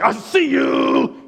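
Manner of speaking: high, loud, drawn-out holler; mock watchful shout. Showing how Jesus is not aware of you, drawn-out high shout. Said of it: "He's not aware like that".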